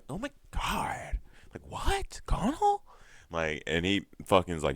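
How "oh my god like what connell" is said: very high falsetto gasping disbelief; hammed-up scandalized falsetto; mock shock. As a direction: imitate stunned friends in a squeaky-high voice. Imitates friends stunned by Connell's outburst.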